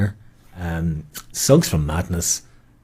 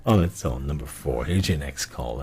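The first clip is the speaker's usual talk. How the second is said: ordinary delivery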